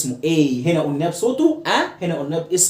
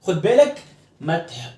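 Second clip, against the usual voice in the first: usual speaking voice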